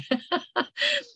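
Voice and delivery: light, high chuckle; short amused laugh. Real, not acted. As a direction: laugh at your own remark. Laughs at her own remark.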